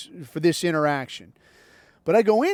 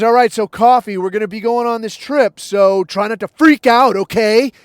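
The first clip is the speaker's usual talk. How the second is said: louder, higher mock teacher voice; retold teacher's warning; 4 semitones higher, 7 dB louder than usual. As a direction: push it out loud like a pushy teacher. Retold teacher briefing the kid.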